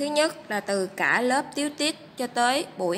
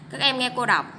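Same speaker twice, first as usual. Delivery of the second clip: ordinary delivery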